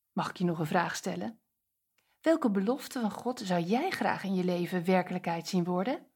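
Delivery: normal talking voice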